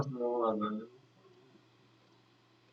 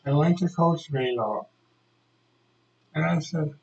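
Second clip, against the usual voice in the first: usual speaking voice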